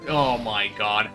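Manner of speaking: ordinary delivery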